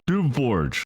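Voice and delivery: name barked high and hard, falling at the end; sharp bark. A narrator's reading. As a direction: snap out "Doomforge" like a commander calling a subordinate. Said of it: "snapped Ridlock Brimstone".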